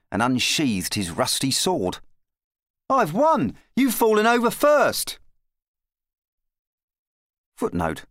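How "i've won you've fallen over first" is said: put-on high-pitched boyish triumph; triumphant lad. A narrator's reading. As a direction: crow over the victory. Boy claims victory over the knight.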